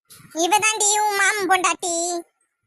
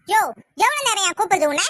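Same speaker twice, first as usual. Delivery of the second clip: ordinary talk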